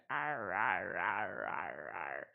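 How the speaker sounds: low drawn-out "arr" growls; frustrated growling noises. Retold or imitated. She re-enacts growling at the setup while the roommate cooks: low growls. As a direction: growl like a cranky pirate at broken gear. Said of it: "I was going".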